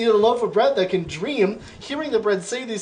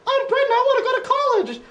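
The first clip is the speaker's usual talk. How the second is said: much higher, cartoonish bread voice, wailing; weeping bread; 3 dB louder than usual. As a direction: squeak it out like a tearful little loaf. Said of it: "It keeps weeping and saying".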